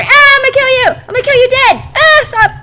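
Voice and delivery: high, loud, wild attack screams; fighting yell. Real, not acted. Gamer attacking a monster, screaming furiously. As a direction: scream it while hacking at the enemy.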